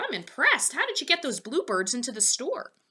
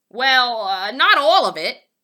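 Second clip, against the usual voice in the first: louder halting reply; hesitant Poppleton; 3 semitones higher, 9 dB louder than usual. A narrator's reading. Poppleton answering Fillmore, loud and hesitant. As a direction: hedge reluctantly.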